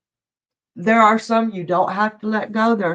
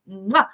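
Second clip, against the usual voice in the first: kiss sound. Real, not acted. Host blowing a kiss to the next guest. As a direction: blow a kiss into the mic.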